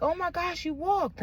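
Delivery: high, hushed gasp of surprise; surprised nurse. Retold or imitated. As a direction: sound amazed, under your breath. Nurse shocked she walked.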